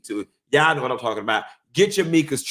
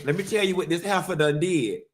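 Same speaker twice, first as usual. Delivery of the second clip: ordinary delivery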